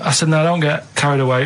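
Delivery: ordinary talk, nothing marked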